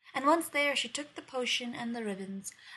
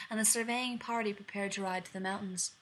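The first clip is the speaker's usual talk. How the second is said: ordinary delivery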